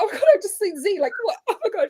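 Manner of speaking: high-pitched pretend fan gasping over a celebrity; mock starstruck. Fans spotting the host in public, mock starstruck.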